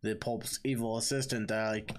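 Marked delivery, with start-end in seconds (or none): none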